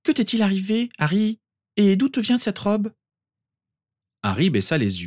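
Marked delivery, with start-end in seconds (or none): 0.0-2.9 put-on much higher girl's voice; curious girl asking; Hermione's voice. A narrator's reading. Hermione asks Harry about his robe, in a much higher voice.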